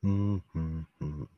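low, soft seductive testimony; sultry narrator. Pretend. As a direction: hotline voice, low and hushed. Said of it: "ni que fuera, este, línea de hotline".

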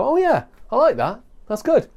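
high, bright "oh yeah, I like that"; delighted audience. Retold or imitated. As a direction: sound suddenly won over and thrilled.

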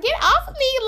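high, exasperated imitation; mock annoyance. Storyteller mimics sister being grabbed by guy, mock annoyance. Sister shaking off clinging man.

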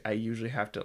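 ordinary talk

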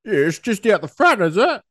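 high mock sceptical voice; imitating Del's disbelieving tease; mocking mate. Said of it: "He was like".

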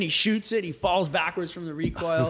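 normal talking voice

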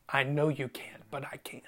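near-whispered, low and fading; hushed reassurance. Acted. God answering someone who says "I can't", hushed reassurance. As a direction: answer softly, trailing into a whisper.

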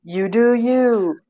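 slow, high mock catchphrase; drawn out for effect; catchphrase delivery. Shown as a demonstration. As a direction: playfully repeat the catchphrase. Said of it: "gonna be the catchphrase".

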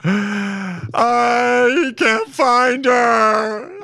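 high, slow, sobbing wail; hammed-up weeping in the shower; mock crying. Dan weeping alone in the shower, hammed-up sobbing. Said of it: "Dan Bilzerian crying".

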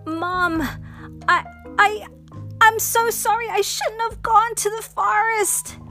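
tearful apology. Acted. Chloe apologizing to her mom, pleading. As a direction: beg forgiveness, near tears.

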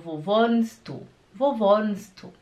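WOHNST DU joined, dropping the T into DU; native linking without T. Shown as a demonstration. Teacher showing how Germans say it, T dropped. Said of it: "eles não falam o T".

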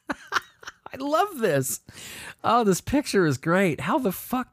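high, slow gushing delight. Real, not acted. Delighted gushing over the bread sculpture picture.

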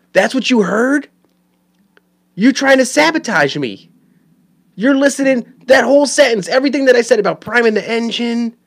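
high-pitched, worked-up indignant rant; exasperated outrage. Acted. Dad to kid who heard only the swear, high indignant rant. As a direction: sputter in disbelief, pitch way up.

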